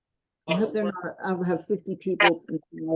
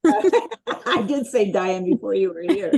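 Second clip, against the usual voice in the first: laughing; 3 semitones higher, 5 dB louder, slower than usual. Real, not acted. Teacher laughing at mixup.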